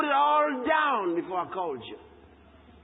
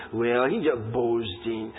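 low, slow sneer of a doubting critic; mocking critic; 11 semitones lower, slower than usual. Preacher mimics critics calling him a boaster, in a low, slow sneer. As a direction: drop way down and drag it out, dismissive. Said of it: "people who look at you and say".

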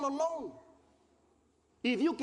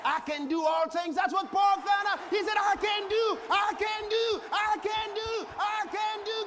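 rising shouted preaching, higher and louder; preaching at full force; 10 semitones higher, 4 dB louder than usual. Acted. Preacher to congregation, climbing to a shout. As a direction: whip the church up with the verse.

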